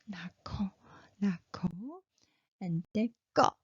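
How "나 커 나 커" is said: whispered 나 커. Shown as a demonstration. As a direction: breathe 나 커 quietly. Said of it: "whispered demo".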